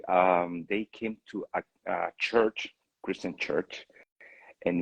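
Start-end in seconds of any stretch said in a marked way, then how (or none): none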